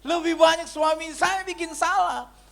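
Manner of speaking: high, loud, drawn-out wife impression; protesting wife. Wife blaming her husband to the pastor, high loud mock protest. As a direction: put on a wife's insistent voice, slow and way up. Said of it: "Dia bilang begini".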